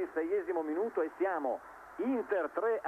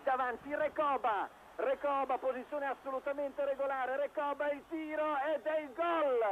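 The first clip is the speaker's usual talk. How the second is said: excited goal call; 6 semitones higher than usual. Real, not acted. Commentator calls a goal.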